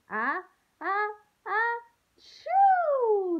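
high, loud building sneeze for the class; a huge pretend sneeze that builds to the choo; big fake sneeze. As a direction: ham up a giant bear sneeze. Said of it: "Let's sneeze together and count three".

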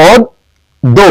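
অদ্য split into two long beats, first vowel a closed "o": "od... do"; pronunciation demo. Shown as a demonstration. Teacher showing students how অদ্য sounds, syllable by syllable. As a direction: draw out অদ্য syllable by syllable with a pause between. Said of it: "উচ্চারণগুলো দেখি একটু".

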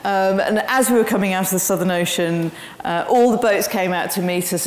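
ordinary delivery

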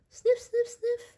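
sniffing. Acted. Imitating a dog sniffing.

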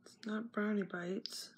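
soft unvoiced whisper; whispered question. Real, not acted. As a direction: breathe it out without voice. Whispering while checking list.

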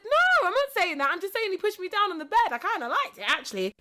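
high, loud, rushed denial; loud retold protest to a schoolmate; defensive protest. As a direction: insist hard, voice jumping up.

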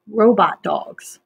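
robot dogs read slowly and clearly; reading the heading. Shown as a demonstration. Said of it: "Look at the heading".

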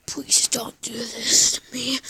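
slow pleading whisper. Acted. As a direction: beg in a slow, breathless whisper.

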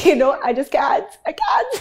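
high-pitched, giggly protest; laughing helplessly. Real, not acted. Guest admitting she cannot stay composed, laughing helplessly. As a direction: give up with a laugh, pitch climbing.

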